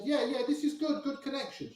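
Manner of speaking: high-pitched mock French receptionist. Receptionist reassures guest about the modem, high attempted French accent. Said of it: "I can't do a French accent".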